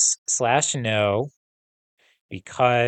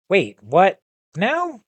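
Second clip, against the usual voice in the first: surprised; 9 semitones higher, 4 dB louder, slower than usual. Acted. Gabe shocked by accusation.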